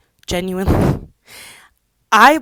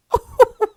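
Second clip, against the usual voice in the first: high burst of laughter; 9 dB louder than usual. Real, not acted. Laughing at absurd plot.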